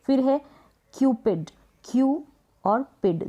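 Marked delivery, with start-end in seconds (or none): 1.8-3.3 Q held alone, pause, और पिड; split into syllables. Shown as a demonstration. Teacher cuts the word in two, Q and पिड separated. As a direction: set Q and पिड apart. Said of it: "दो इसके break किए".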